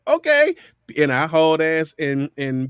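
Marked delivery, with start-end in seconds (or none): 0.1-0.5 much higher mock teacher okay; imitating the teacher; teacher allowing. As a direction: grant it breezily. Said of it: "She's like".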